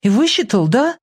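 put-on high, wondering girl's question; surprised girl. A narrator's reading. Girl checking he worked it out, surprised. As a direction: ask high-pitched, in amazement. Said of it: "удивлённо спросила девочка".

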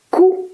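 short high rooster note; crowed syllable. A narrator's reading. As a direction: crow one syllable to be copied.

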